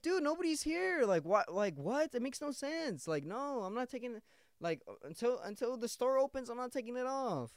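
high-pitched, incredulous arguing; exasperated protest. Retold or imitated. Employee refusing manager over earphone, exasperated protest. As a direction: argue back high and fed up.

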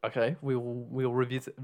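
revisit said the French way, as ree-vee-zee; French accent. Real, not acted. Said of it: "Oh, God, I went French then".

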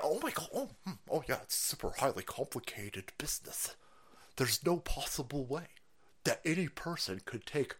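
quiet, low mocking voice of an expert; mocking nerdy voice. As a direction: mumble it like a smug know-it-all, drawing out the end. Mocking the guest's claim.

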